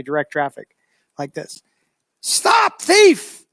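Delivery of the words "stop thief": high, loud shout after a thief; shouting stop thief at a fleeing thief; yelling for help. Retold or imitated. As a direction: yell it down the street to stop him. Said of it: "I'm yelling".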